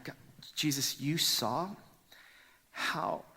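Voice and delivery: normal talking voice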